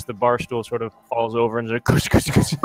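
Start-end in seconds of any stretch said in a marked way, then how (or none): 1.8-2.5 unvoiced clattering crash noises; imitated crashing. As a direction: mimic a bar stool toppling over. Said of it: "sort of a big crash".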